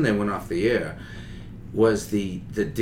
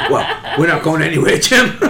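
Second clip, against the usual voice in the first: laughing through a mock boss line; 6 dB louder than usual. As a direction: talk while cracking up. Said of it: "they brought me in a room and said".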